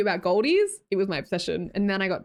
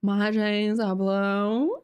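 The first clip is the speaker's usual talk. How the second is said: very slow, exaggerated drawl, "blue" swooping up at the end; much slower than usual. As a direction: ham up the ad line, every word stretched way out. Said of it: "but it's like".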